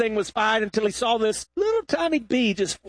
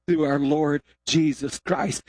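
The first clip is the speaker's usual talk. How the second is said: scripture reading; 4 semitones lower than usual. Acted.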